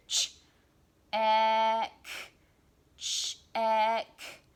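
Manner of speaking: ch and ek pulled apart with a gap between; segmenting the sounds of check aloud; word stretched into sounds. Shown as a demonstration. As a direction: sound out ch, then a loud ek. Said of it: "said it really slowly and listened for the sounds".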